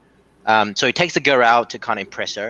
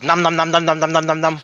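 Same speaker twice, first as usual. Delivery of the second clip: high, bouncy num num chant; playful nums; 5 semitones higher than usual. Retold or imitated. Doctor pretending to numb patient's breasts, high playful nums. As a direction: mimic a doctor numbing the breasts, nom nom.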